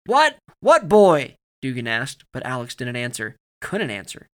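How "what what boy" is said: loud, high yelled questions; incredulous shout. A narrator's reading. Soldier questioning his comrade's answer, shouting. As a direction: fire the questions back at a yell. Shouted exchange after the shooting.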